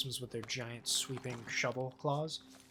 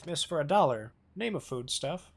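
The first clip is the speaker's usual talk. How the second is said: mock game show; 4 semitones higher, 8 dB louder than usual. Game-show style call.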